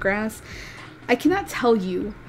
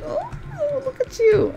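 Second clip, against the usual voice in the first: excited cooing. Real, not acted. Admiring a kit in game.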